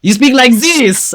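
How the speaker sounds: put-on French accent; hammed-up French accent. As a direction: instruct firmly in mock French English. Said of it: "You need to speak with the French accent".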